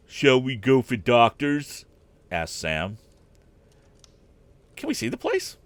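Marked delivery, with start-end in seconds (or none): 0.1-1.8 put-on slow, louder eager boy. A narrator's reading. Sam voiced. 4.7-5.6 high blurted question; blurting out. A narrator's reading. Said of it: "blurted out Pete".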